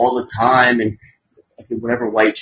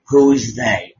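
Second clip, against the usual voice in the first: ordinary talk, nothing marked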